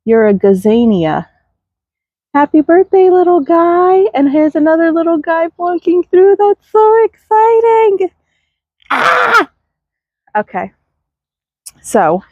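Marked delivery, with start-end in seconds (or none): 2.3-8.1 high, sing-song voice for tiny seedlings. Real, not acted. Gardener greeting new seedlings, high delighted coo. 8.9-9.5 excited squeal. Real, not acted. Squeal over seedlings.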